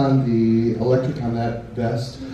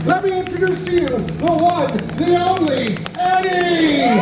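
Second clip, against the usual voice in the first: announcer voice. Acted. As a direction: hype the crowd like a ringmaster. Announcing the creature on stage.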